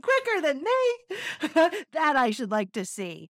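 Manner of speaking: high, loud, laughing scorn. Acted. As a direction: scoff through laughter. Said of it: "The hare burst out laughing".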